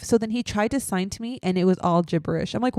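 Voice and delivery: ordinary delivery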